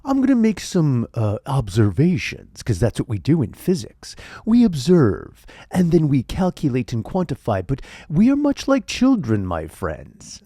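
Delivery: hammed-up science sermon with swooping stresses, falling away at the end; Neil deGrasse Tyson. Scientist explains physics to his friends, grand impression. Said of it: "how do you like this impression?".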